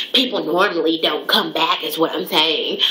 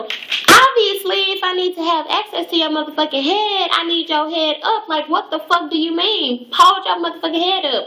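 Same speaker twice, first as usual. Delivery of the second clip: much higher, heated outburst; exasperated rant; 10 semitones higher than usual. Real, not acted. Venting about a client who keeps her head down, high and exasperated. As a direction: let the disbelief spill over, pitched way up.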